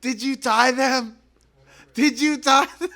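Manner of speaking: very high, slowed, full-volume yell; hammed-up squealed accusation; mock outrage. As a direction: imitate a cousin shrieking the question. Acted panicked cousin, repeated louder.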